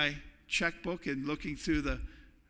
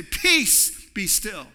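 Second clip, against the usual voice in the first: commanding shout; 3 semitones higher, 7 dB louder, much slower than usual. Shown as a demonstration. Preacher showing the congregation how to command the storm, shouting. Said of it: "Rise up ... speak to that storm".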